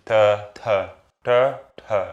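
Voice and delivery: "T, t, t, t" as four distinct Hindi T's, dental and retroflex, unaspirated and aspirated; four Hindi T sounds. Shown as a demonstration. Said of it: "Listen to these four T's".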